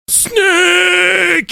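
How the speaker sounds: long, high mock shriek; imitated child's scream. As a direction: shriek it out like a little girl. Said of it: "She started screaming, like".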